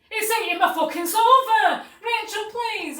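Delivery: high, loud mock wail; acted desperate plea. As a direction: beg at a shout, imitating total panic. Retold desperate plea for rescue.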